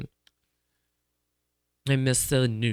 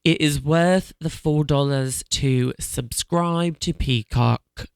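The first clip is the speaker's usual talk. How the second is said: put-on light British accent, slow; slow, lightly British endorsement; slower than usual. Telling listeners to subscribe to Peacock, light British accent. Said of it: "You started speaking in a British accent".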